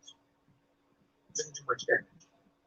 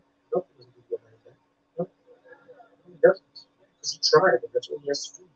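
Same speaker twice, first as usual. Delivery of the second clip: ordinary talk, nothing marked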